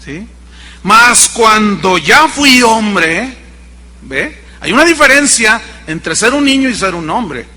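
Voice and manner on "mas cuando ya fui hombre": slow emphasis. Acted. Slow emphatic scripture quote.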